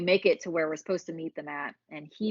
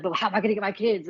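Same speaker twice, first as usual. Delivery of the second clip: fast, louder, higher panicked question; 4 semitones higher, 6 dB louder, faster than usual. Retold or imitated. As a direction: blurt it out in a rush of fear. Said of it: "I'm freaking out".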